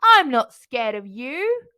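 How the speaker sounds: slow, loud mock pirate shout; pretend pirate shout. Small boy playing pirate to the bear, slow loud mock shout. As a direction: boom it out like a pretend fearsome pirate. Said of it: "shouted in his loudest voice".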